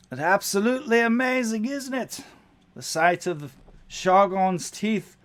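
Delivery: high, loud, awestruck goblin. Acted. Goblin marvels at Shargon's teeth to the crew, high and loud. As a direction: gush about the view like an excited little goblin.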